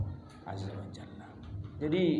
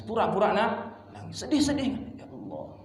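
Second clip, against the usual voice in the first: lightly acted weepy Ya Allah; tearful plea; 10 semitones higher, 4 dB louder than usual. Shown as a demonstration. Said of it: "dengan suara yang sendu-sendu seperti akan menetes".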